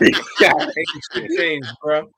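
drawn-out, laughing through the words; laughing repeat. Real, not acted. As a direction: savour the punchline, stretched out slowly.

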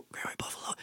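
whisper. Real, not acted. Breath gives out mid list.

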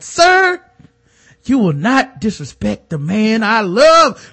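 high, loud, slow overacted declaration; imagined line for James, hammed-up and grand; dramatic soap declaration. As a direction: ham it up like a soap climax. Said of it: "be like".

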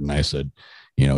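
normal talking voice

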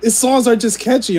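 can't stop laughing while admitting it; real laughter bubbling through the words. Real, not acted. Laughing while defending [name].

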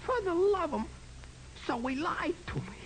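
outraged. Acted. Johnny learns he was lied to.

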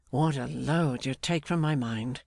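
ordinary delivery, nothing marked

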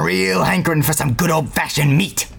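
put-on hammy old grandpa voice; eccentric old man. Grandpa bargains with the party for meat, eccentric old-man voice. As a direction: wheedle as a crazy old man.

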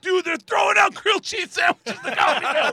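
high, loud shout of amazement; hammed-up ecstatic shouting; excited crowd member. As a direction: yell it like a thrilled audience member. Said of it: "With people going".

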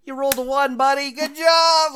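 high, bright encouraging parent voice; sing-song praise to toddler. Retold or imitated. Dad praising toddler's bad roll.

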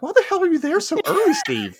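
high-pitched, laughing disbelief; mock exasperation. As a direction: squeal it at a hopeless friend. Mock exasperation at Steve's early arrival.

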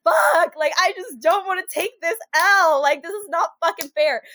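loud, high retold frustration; acted indignant whine of defeat; exasperated outburst. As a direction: burst out high, then ease down into complaint. Reliving frustration at being stranded.

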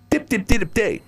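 high, short staccato dips; sing-song beeping on a high note; imitated dial tone. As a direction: mimic phone beeps in a high pitch. Imitating a dead line.

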